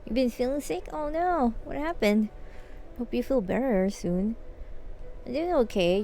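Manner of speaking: usual speaking voice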